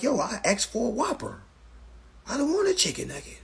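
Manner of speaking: high-pitched indignant protest; acted customer complaint. Acted complaint at fast-food counter.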